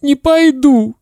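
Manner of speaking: very high, tearful refusal; sobbing boy. Acted. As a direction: whine it through sobs. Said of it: "всхлипывая, сказал Славик".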